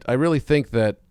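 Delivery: usual speaking voice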